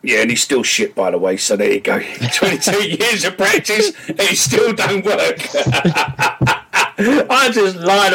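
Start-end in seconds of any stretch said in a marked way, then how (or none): 2.0-8.1 laughing. Real, not acted. Laughing while mocking his cricket.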